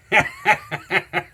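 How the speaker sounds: loud, giddy burst of laughter; giddy high laugh. Real, not acted. Giddy at the unboxing.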